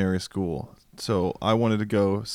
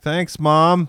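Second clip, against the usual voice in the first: sarcastic; 7 semitones higher, 6 dB louder than usual. Retold or imitated. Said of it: "I was like".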